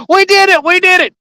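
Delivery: high-pitched cheering sports fan; imitated fan screaming. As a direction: whoop it up like a fan off the couch. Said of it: "screaming and stuff and go".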